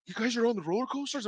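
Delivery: high, quick, mock breathless question; out of breath. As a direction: ask it as if winded from running uphill. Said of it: "all out of breath, like, running up the hill".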